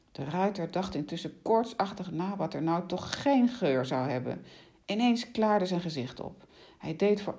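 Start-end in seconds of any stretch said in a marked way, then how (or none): none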